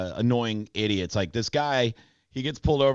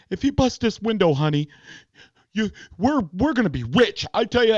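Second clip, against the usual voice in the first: worked-up boast; 10 semitones higher, 5 dB louder than usual. Retold or imitated. Acted driver boasting to partner.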